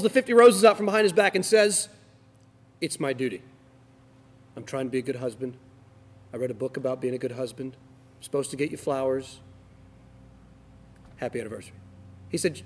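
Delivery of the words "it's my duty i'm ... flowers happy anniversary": low, soft, joyless monotone; dutiful drone. Retold or imitated. Husband handing his wife roses out of duty, joyless and flat. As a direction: recite it like a chore.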